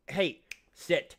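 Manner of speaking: high, loud sit command; loud call to the dog; sharp command. Real, not acted. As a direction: snap an order at the dog.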